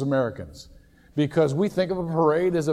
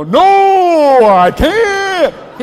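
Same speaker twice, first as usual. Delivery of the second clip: high, loud booming announcer reply; 14 semitones higher, 14 dB louder, much slower than usual. Retold or imitated. Imagined ringmaster answering the pastor, still booming. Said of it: "He'd probably go".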